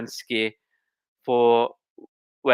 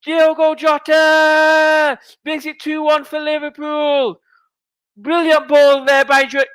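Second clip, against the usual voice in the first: high, slow, loud and jubilant; ecstatic shout; 12 semitones higher, 4 dB louder, slower than usual. Real, not acted. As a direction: shout the scorer's name out in triumph. Celebrating the goal.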